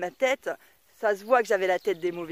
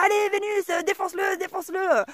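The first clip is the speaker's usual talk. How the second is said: much higher, a rowdy egging-on cheer; cheering crowd; 9 semitones higher than usual. Pretend. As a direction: hype her up like a chanting horde. Imagined horde cheering her on.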